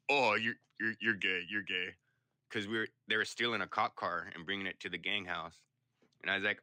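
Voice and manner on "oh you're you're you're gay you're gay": mocking taunt. Retold mocking taunt in jail.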